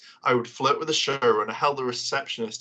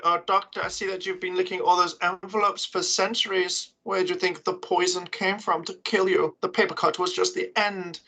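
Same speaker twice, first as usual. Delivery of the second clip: a petty bureaucrat villain savouring every word; playing the villainous Postmaster taunting the Doctor, higher and prim; 6 semitones higher than usual.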